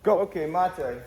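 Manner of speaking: loud drill command; loud instructor's call. Real, not acted. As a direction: call it out to the whole class. Instructor calling start and stop of drill.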